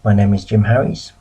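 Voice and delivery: ordinary talk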